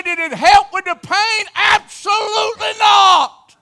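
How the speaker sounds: high, loud preaching cry; preaching shout. Real, not acted. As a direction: hammer the answer home from the pulpit. Preacher's climax answer.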